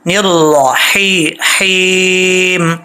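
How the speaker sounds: slow, deliberate Quranic ending; careful ending. Shown as a demonstration. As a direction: stretch निर्रही- then हीम very slowly. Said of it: "तवज्जो से, अ, read कीजिएगा".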